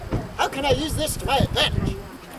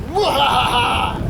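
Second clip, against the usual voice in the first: slow evil villain cackle; villain laugh; 3 dB louder, slower than usual. Acted. Villain gloating over scheme.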